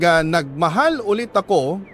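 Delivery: ordinary delivery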